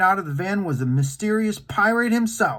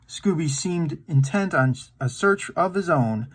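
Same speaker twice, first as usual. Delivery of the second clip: ordinary delivery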